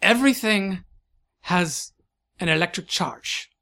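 put-on emphatic correction in German-French accent; halting, lilting accented lecture; accented eccentric scientist. Scientist correcting a visitor's guess, German-French accent.

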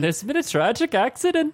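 high-pitched fake Irish veering off course; botched Irish accent. Shown as a demonstration. Echoing the co-host, botched high Irish accent. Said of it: "That veered into, like, a different type of nationality".

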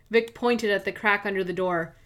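plain reading voice

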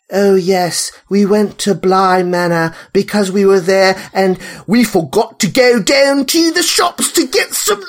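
lightly mocking English actress accent, laughing at the end; imitating a wobbly British accent with a laugh. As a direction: send up a shaky English accent, giggling. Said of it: "she's doing this thing where".

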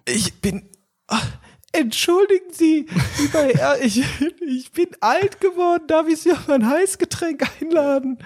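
put-on high, wavering old man. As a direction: play a frail, polite grandpa. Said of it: "Du redest schon, als wärst du achtzig".

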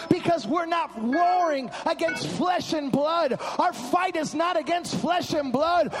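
loud, high-pitched preaching building to a shout; fired-up preaching. Real, not acted. Preacher rallies congregation against spirit of racism, fired-up preaching. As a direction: drive it home like a revival preacher at full cry.

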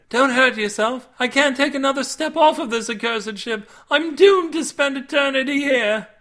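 high, loud lamenting maiden; Cindy. A narrator's reading. Maiden stuck at the rail, high lament. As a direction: wail it as a doomed captive. Distressed character dialogue in narrated story.

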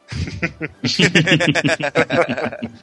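sinister villain cackle; unhinged evil laugh. Acted. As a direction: laugh like a scheming supervillain. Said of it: "Essa risada maligna foi ótima".

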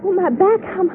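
high pained yelps. Acted. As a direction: wince from the sunburn. Sunburned back hurts.

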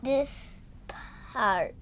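breathy secret whisper. Real, not acted. Child whispers secret answer.

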